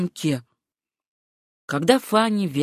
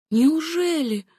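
a high, surprised question; light astonishment; 9 semitones higher, slower than usual. A narrator's reading. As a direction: caught off guard by the late hour. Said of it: "удивилась Сюзанна".

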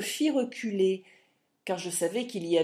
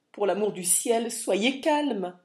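loud, high-pitched plea for calm; alarmed cry; 3 semitones higher, 6 dB louder than usual. Acted. Lawyer loudly begs the furious husband to calm down. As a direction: cry out to hold back the raging man. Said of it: "s'écria l'homme de loi".

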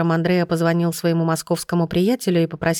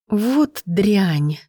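drawn-out exclamation; 3 semitones higher than usual. A narrator's reading. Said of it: "протянула Катя".

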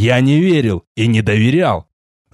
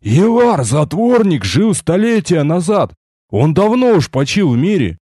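astonished croak; 6 semitones higher than usual. A narrator's reading. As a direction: croak it out in disbelief. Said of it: "сумел прохрипеть, ничуть не скрывая обуревавшего меня изумления".

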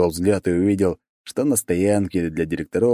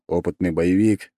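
ordinary delivery, nothing marked

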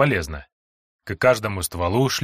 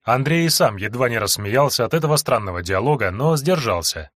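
ordinary delivery

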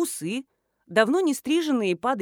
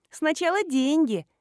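put-on higher, firm girlish voice; unyielding young extortionist; 6 semitones higher, 4 dB louder than usual. A narrator's reading. Schoolgirl insists on payment first, unyielding girlish voice. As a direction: hold out for the cash. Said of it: "не сдалась вымогательница".

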